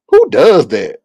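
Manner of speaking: usual speaking voice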